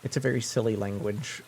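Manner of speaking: ordinary talk, nothing marked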